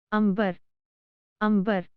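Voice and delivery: अंबर with the nasal as म before ब; careful demo word. Shown as a demonstration. Teacher models अंबर for learners, careful demo. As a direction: model अंबर clearly twice. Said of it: "जैसे".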